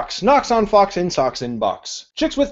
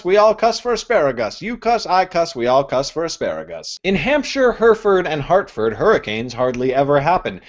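normal talking voice

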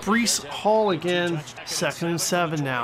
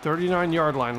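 ordinary talk, nothing marked

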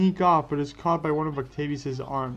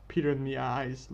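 usual speaking voice

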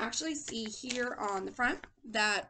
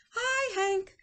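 high, sing-out friendly hello; calling out; 10 semitones higher, 5 dB louder than usual. A narrator's reading. Rosie the tumbleweed greets Hank, calling out high. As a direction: call a greeting in a raised pitch. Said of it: "Rosie the tumbleweed called out".

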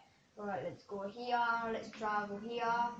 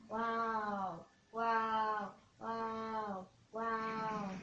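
ordinary delivery

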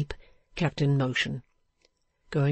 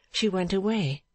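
usual speaking voice

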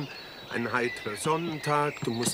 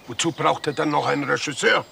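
offended; 3 semitones higher, 6 dB louder than usual. Real, not acted. Offended director snaps back.

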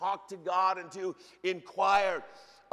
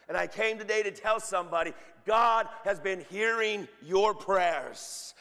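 full-force preaching; 6 dB louder than usual. Acted. Preacher declaring God's answer to prayer, loud and emphatic. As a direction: proclaim it to the congregation, leaning hard on each phrase.

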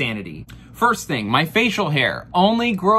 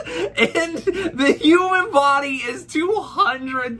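higher, slow words through laughter; laughing high; 9 semitones higher, slower than usual. Real, not acted. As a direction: laugh while saying it.

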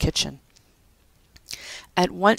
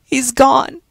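high, loud sobbing words; sobbed; 9 semitones higher, 8 dB louder than usual. A narrator's reading. Winnie tells Pat about Joe, sobbing. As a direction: break down as you say it. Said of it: "Winnie sobbed".

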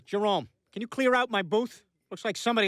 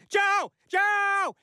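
very high, full yelled name; competitive yelling; 14 semitones higher, 3 dB louder than usual. Acted. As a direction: outdo your rival yelling for a friend. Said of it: "I can yell it louder".

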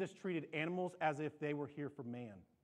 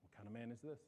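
much softer, lower, as if to himself; hushed awe; 5 semitones lower, 10 dB quieter, faster than usual. Acted. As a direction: let the wonder drop to a hush. Awed rhetorical question.

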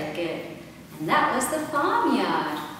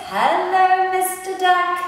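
light little dog voice; 6 semitones higher, 4 dB louder than usual. Acted. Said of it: "the little duck says".

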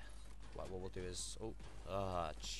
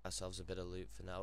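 normal talking voice

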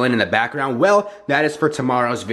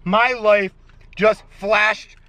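shocked; 11 semitones higher than usual. Real, not acted. Shock after a scare.

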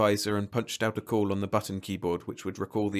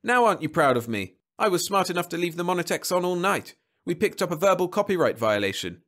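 higher, louder proud boast; crowing; 8 semitones higher, 4 dB louder than usual. A narrator's reading. Husband boasting to wife about his catch, crowing. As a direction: show off with pride. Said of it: "crowed Jeb".

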